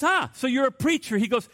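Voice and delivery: a hammed-up taunting heckler voice; mocking heckler.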